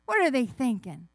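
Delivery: usual speaking voice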